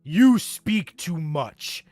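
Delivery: loud, slow thundering roar; furious divine roar. Acted. Storm god's sudden outburst.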